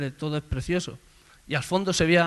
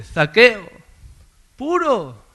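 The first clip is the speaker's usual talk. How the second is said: loud high call up into the tree; 7 semitones higher, 8 dB louder, much slower than usual. Acted. Jesus calling Zacchaeus in the tree, loud call. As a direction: call his name out over the crowd.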